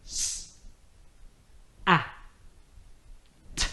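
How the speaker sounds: soft separate letter sounds; s, a, t each alone; sounding out words. Shown as a demonstration. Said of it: "sound it out together".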